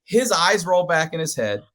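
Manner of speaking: normal talking voice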